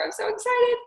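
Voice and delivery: gushing excitement. Shown as a demonstration. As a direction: gush like a thrilled friend. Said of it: "leave a voice message saying".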